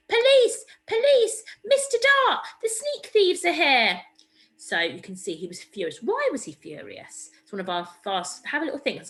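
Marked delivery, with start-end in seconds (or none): 0.1-4.0 very high, loud yell for the police; loudest terrifying shout. A narrator's reading. Stanley yells for the police at the thieves, a very high loud shout. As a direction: scream for help at full volume. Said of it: "shouted in his loudest, most terrifying voice".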